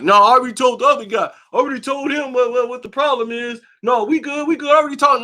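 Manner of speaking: a put-on high, fast, scared voice; mocking Jamal. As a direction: squeak out the excuses in a rush. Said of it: "Jamal quoted again".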